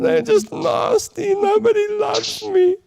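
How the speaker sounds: high, hammed-up sob of self-pity; mock crying. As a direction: whine like a lonely sad sack. Self-pity act, "tiniest violin.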